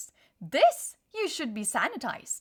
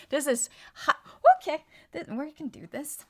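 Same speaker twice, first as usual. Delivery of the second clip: ordinary talk, nothing marked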